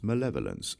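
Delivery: usual speaking voice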